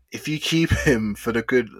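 ordinary talk, nothing marked

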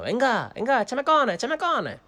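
high, loud dare from the brother below; goading. Retold or imitated. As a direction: egg him on in a high, insistent voice. Brother daring him, acted out.

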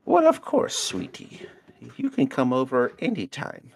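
put-on high sweet old lady voice. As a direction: coo like a creepy granny. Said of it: "with this voice".

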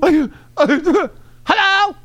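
very high put-on hello; mock caller. Host butting in as a joke caller, high silly voice. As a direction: pipe up in a high comic voice.

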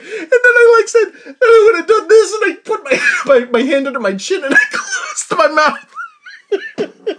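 high, loud, helpless laughter through the words; laughing hard. Real, not acted. Telling the dark joke played on mom, cracking up. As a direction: barely get it out for laughing.

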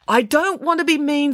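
high-pitched, careful let-down; apologetic firmness. Acted. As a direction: cushion a firm refusal. Friday rejecting Parker, apologetic yet clear.

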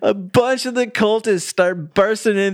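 laughing. Real, not acted. Laughing while retelling a wild scene.

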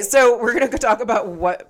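usual speaking voice

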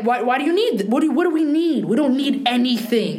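high-pitched imitation of mom dismissing worries; insistent mother. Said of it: "But she always was like".

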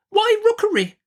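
insistent Miss Betsy. A narrator's reading. Aunt demands the reason for the house name, insistent. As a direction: press for an answer in a very high voice.

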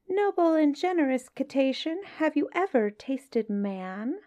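high, sly little fish voice; small astute voice. Acted. Small clever fish flatters the whale, high sly voice. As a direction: sweet-talk the whale in a tiny clever voice. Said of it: "said in a small 'stute voice".

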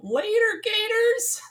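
very high, incredulous squeak; squeaky, questioning echo of the phrase; mock English disbelief. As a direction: pitch it way up as a baffled question. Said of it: "people in England say".

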